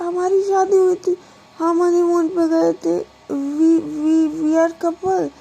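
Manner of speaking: put-on high, whiny drunk wife pleading; drunk whining plea. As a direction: beg him to remember the wedding, tearful. Drunk Pragya pleads to be remembered.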